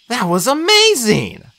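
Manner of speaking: high, drawn-out admiration; slow, high-pitched awe; amazed praise. A narrator's reading. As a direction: gush with wonder. Admiring agreement after the battle.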